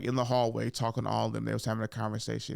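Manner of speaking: ordinary delivery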